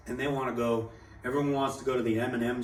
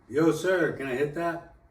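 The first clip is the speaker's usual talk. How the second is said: higher, louder re-enacted request; retold calling out; 5 semitones higher, 4 dB louder than usual. Himself asking a stranger for a hit, calling out. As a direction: act out piping up to a stranger.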